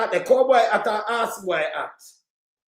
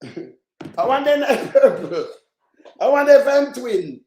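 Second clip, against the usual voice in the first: breaking up with laughter mid-sentence; laughing; 4 dB louder, slower than usual. Real, not acted. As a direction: try to get the line out through laughs. Cracks up at own joke.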